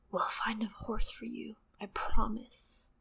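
soft whispered promise to a little brother; hopeful whisper. Acted. Big brother whispering a promise to Bran in the dark, hushed and hopeful. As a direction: breathe it out gently, full of hope. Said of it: "with such hope in his voice".

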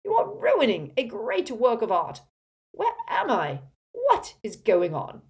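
loud, high, imperious bluster; haughty indignation at full volume; outraged pompous portrait. Acted. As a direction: bellow like an affronted old headmaster.

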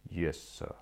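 low, cold butler reply; deep and cold. A narrator's reading. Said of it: "in a low, cold voice".

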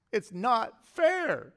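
put-on high, loud, drawn-out child's protest; youngest daughter. Preacher mimicking his youngest daughter's complaint, whiny kid protest. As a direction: imitate a kid complaining, stretching every word. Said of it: "is what my youngest daughter says".

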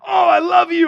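loud, high, hammed-up adoring cry; joyful outburst. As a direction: imitate a man overjoyed to be alive. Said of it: "kind of reborn".

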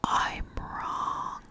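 hushed, drawn-out whisper; barely-there whisper; whispered admission. Real, not acted.

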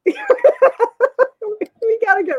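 high, loud, stretched out through laughter; gleeful, laughing rally; laughing excitement. Real, not acted. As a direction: whoop it slowly while laughing. Laughing at editor's return.

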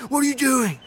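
high alarmed shout; quoting a startled Stallone; startled yelp. Retold or imitated. Movie quote acted in shower.

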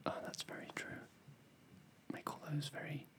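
joining in the whisper game; playful whisper. Acted.

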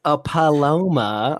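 put-on drawn-out valley girl Paloma.

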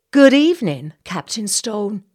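high, loud, bright greeting; petite woman greeting. Acted. Society lady greeting the captain, high and loud. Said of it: "a petite woman said".

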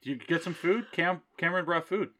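high-pitched eager announcement; very excited. Acted. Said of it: "You sound very excited".

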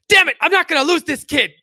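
high, loud TV-surgeon outcry; hammed-up emergency-room desperation; desperate doctor. Doctor trying to save Kenny, loud mock desperation.

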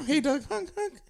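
high, laughing goofy honk; silly honking voice. Retold or imitated. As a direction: honk it like a clown horn. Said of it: "He's all".

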